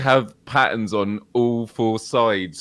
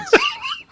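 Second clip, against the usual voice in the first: high squeal of laughter. Real, not acted. Laughing at the dull unboxing.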